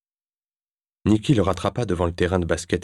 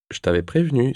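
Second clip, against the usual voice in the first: higher-pitched, sighing I-told-you-so; sighed reproach; 7 semitones higher than usual. A narrator's reading. Nicky to Sébastien after his spicy mouthful, sighing. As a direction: sigh it out as a mild reproach. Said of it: "soupira Nicky".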